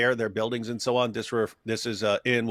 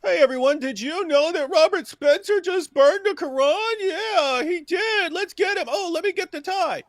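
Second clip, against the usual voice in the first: much higher, louder rabble-rousing cry; mock mob rouser; 4 dB louder than usual. Mocking a neighbor rousing the mob over a tie, high loud call. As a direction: whip up the crowd in a shrill mocking pitch.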